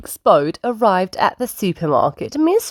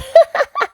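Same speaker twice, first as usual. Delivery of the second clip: very high-pitched acted child laugh; high piglet giggle; 4 dB louder than usual. A narrator's reading. Peppa looking at the water, high acted giggle. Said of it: "Peppa laughed".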